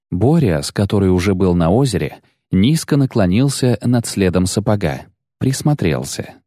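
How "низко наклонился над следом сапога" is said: ordinary delivery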